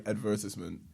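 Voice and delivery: "advertisement" as ad-VER-tiss-ment; English pronunciation. Shown as a demonstration. British guest on how some English say it, ad-VER-tiss-ment. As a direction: stress VER in "advertisement", short i in tis. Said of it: "Some people in England say".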